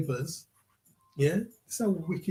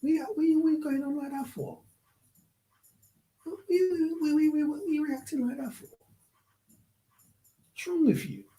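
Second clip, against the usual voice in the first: much higher, stuttering mockery; mocking doctor; 12 semitones higher than usual. Doctor ridiculing a dying patient's reaction, high mocking mimicry. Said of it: "had the nerve to look at the patient like".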